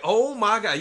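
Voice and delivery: loud, high exclamation; mock exasperation. Real, not acted. Reacting to the girl's choice, mock exasperation. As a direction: burst out in comic disbelief.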